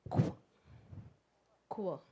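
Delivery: "qu" as "kw"; qu sound. Shown as a demonstration. Teacher showing the QU blend. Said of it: "So this makes".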